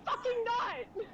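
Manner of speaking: high-pitched indignant protest; exasperated high objection; Fiora. Acted. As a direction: voice an outraged character up high. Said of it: "you hear Fiora like".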